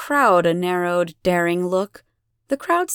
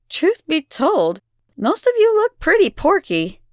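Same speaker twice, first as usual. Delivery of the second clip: brazen candor; 8 semitones higher, 5 dB louder than usual. A narrator's reading. Elena deflects the crowd's complaint, brazenly mocking. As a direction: draw it out, then jab.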